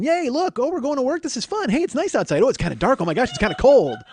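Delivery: high-pitched, hammed-up giddy chatter; idiotic excitement. Clingy boyfriend riding along to her work, mock giddy. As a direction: gush like an overexcited idiot on an outing. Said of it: "sitting there like an idiot going".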